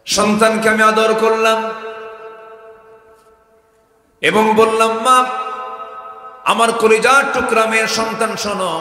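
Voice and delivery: loud, high chanted endearment; full-force preaching. Acted. Father calling his beloved daughter, full-force preaching. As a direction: proclaim it to the crowd in a high chant.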